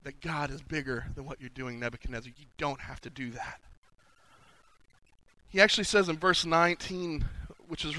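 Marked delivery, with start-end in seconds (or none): 0.0-3.6 soft, hushed appeal; quiet plea. Retold or imitated. Daniel telling the king God is bigger, hushed gentle appeal. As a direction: speak low, as if only to him. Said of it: "And he goes".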